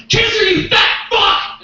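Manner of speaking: high, loud, drawn-out abusive yell; inner voice yelling. Acted. Gentle inner voice turns on the comic, sudden abusive yell. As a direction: burst into a high-pitched shout at yourself. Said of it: "I heard this gentle little voice".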